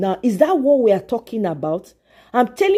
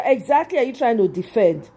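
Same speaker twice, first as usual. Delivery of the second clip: ordinary talk, nothing marked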